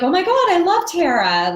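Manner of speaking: high, loud delighted gush; excited gush. Retold or imitated. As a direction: gush about Tara like a fan. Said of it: "And she was like".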